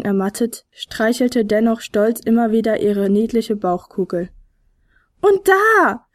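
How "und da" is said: high, eager exclamation; calling out. A narrator's reading. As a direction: call it out high to point something out. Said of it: "rief Fionella". (hoch und lebhaft gerufen)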